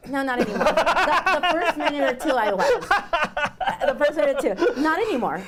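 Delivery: high, loud laughing reply; giggly high denial. Real, not acted. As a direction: laugh it off brightly. Laughing about having been nervous.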